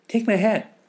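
put-on very high, loud child voice; eager little friend. Friends handing over clothes, high eager child.

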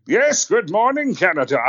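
booming, very high, slow theatrical greeting; grand slow Shakespearean hello; Brian Blessed impression. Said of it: "It's Brian Blessed".

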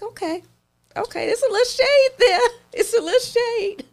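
high-pitched squeal breaking into laughter; amused mock reaction. Own amused reaction to shade.

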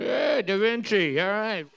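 normal talking voice

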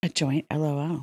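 low, soft, breathy purr; sultry romance voice. Shown as a demonstration. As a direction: romance novel narrator voice. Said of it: "in our sexiest romance novel voice".

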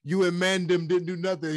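put-on high, laughing London roadman accent. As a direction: repeat the quote in a London street accent. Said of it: "they try to use different accents".

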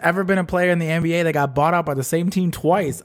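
ordinary talk, nothing marked

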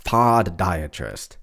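slow "podiatrist", split as POD-diatrist; "podiatrist" drawn out, opening on a clear POD. Shown as a demonstration. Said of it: "Why don't we say it like that?".

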